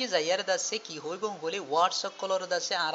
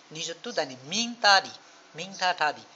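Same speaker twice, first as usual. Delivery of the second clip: normal talking voice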